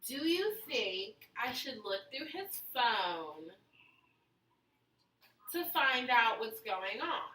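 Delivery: put-on slow Valley Girl question, rising at the end. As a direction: ask it in a drawn-out Valley Girl voice. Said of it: "You're not gonna do your Valley Girl voice?".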